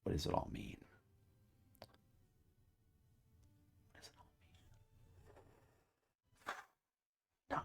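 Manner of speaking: hammed-up conspiratorial hush; mysterious whisper. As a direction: whisper like sharing a deep mystery. Mysterious pondering to viewers.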